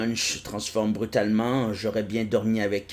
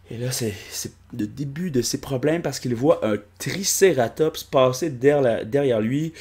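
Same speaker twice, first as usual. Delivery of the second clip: ordinary talk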